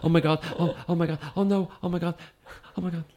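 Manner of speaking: high, whiny mock panic; panicked whining. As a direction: imitate a panicking actress, whining. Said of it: "Sandra Bullock bitching and moaning".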